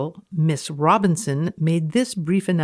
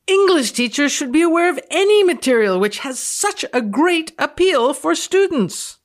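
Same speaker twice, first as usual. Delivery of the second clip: mildly angry reading; 8 semitones higher than usual. Acted. Reading the teacher's warning aloud, mildly indignant. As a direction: lean into the warning with rising indignation. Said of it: "You sound angry".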